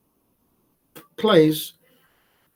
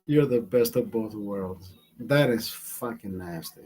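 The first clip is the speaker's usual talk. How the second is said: disgusted; 8 semitones lower, 5 dB quieter than usual. Real, not acted. Disgusted repeat, low.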